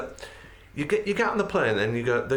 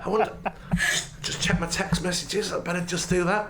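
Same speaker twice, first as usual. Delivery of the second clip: dawdling passenger; 4 semitones higher than usual. Retold or imitated. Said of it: "And then they go".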